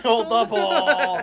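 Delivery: high, slow, laughing call; drawn-out echo. Retold or imitated. Teller echoes the pole call, drawn-out mock holler. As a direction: stretch each word out high, half laughing.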